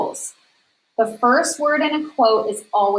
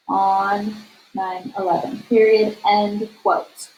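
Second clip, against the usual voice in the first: dictating. Shown as a demonstration. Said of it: "dictating the sentence".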